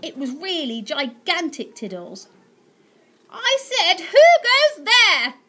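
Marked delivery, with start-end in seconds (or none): 3.3-5.3 put-on high, loud, slow demand from a haughty old aunt; shrill aunt challenge. Aunt Greta challenging the intruder, high and loud. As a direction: bellow it down at the intruder. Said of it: "repeated Aunt Greta".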